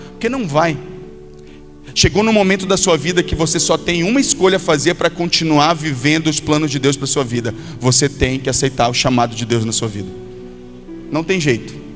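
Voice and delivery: usual speaking voice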